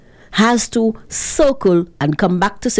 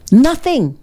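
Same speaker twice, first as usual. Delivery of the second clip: emphatic nothing; 8 semitones higher, 4 dB louder than usual. Real, not acted.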